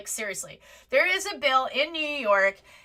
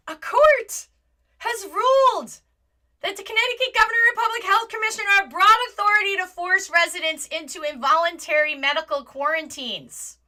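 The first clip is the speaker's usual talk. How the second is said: higher, louder, urgent reading; alarmed headline read; 5 semitones higher, 6 dB louder than usual. Real, not acted. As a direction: announce it like breaking news. Reads headline twice.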